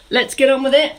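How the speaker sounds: rally the group to start moving; upbeat rallying call. Shown as a demonstration.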